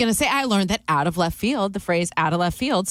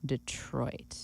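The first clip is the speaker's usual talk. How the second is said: unsure whispered guess of Detroit; 5 semitones lower, 14 dB quieter than usual. Real, not acted. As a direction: breathe Detroit out as a doubtful guess.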